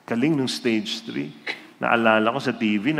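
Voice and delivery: normal talking voice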